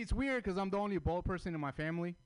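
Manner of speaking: usual speaking voice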